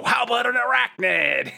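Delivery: high-pitched put-on character voice; villain's interjection. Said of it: "you hear the voice go".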